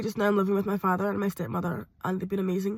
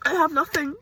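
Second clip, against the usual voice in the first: crying; 4 semitones higher, 3 dB louder than usual. Real, not acted. Crying, repeated line.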